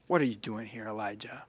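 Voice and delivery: the still small voice, hushed and gentle; gentle whisper. A narrator's reading. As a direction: let it fall to a soft hush. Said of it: "came a gentle whisper".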